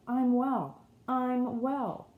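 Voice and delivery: put-on a boy crying out that he is well; joyful exclamation. Boy to his friends in the garden, excited and joyful.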